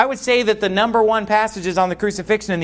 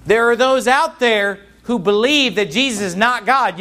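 normal talking voice

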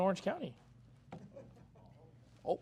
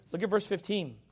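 usual speaking voice

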